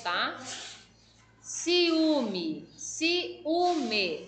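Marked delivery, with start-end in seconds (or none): 1.4-4.2 ciúme drawn out, leaning on the "ú"; stress on middle syllable. Shown as a demonstration. Teacher showing pupils the tonic syllable, drawn-out and emphatic. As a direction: repeat ciúme slowly, syllables apart, "ú" strongest. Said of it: "o u é a sílaba tônica".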